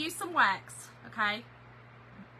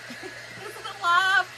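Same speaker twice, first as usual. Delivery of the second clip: laughing through the question; giggly disbelief; asked with a laugh; 5 semitones higher than usual. Retold or imitated. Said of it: "I was like".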